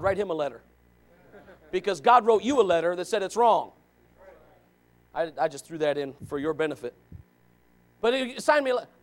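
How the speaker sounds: ordinary talk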